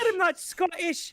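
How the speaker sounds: high, slow garbled brogue; drawn-out bad Irish-Scottish accent; hammed-up Irish-Scottish protest; mock Irish-Scottish accent. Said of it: "I'm gonna do an accent for you".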